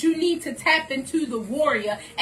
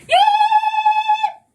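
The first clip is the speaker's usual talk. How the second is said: sudden high, piercing yell; battle cry; 7 dB louder than usual. Shown as a demonstration. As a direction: scream it out like a warrior charging. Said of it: "Mine is".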